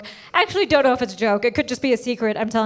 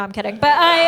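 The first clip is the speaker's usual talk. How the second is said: normal talking voice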